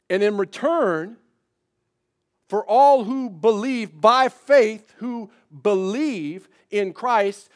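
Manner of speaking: loud, slow, rising preacher's build; full-force preaching. Acted. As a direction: preach it out to the congregation, pressing each phrase.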